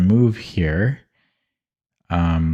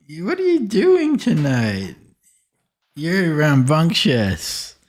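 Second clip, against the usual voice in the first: pet talk; 7 semitones higher than usual. Real, not acted. Talking to dog.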